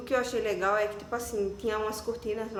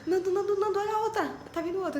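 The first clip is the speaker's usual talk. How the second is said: urgent call; 10 semitones higher, faster than usual. Retold or imitated. Woman in labor calling her husband back, high urgent call.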